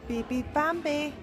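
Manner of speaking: ordinary delivery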